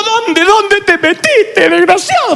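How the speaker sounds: a son imitating his mother scolding him when lost; mother's scolding. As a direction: play the frantic mother. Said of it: "la de mi mamá".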